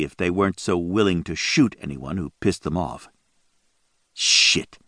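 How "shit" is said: low unvoiced mutter; muttered curse, near whisper. A narrator's reading. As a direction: breathe the curse under your breath. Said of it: "Daniel muttered".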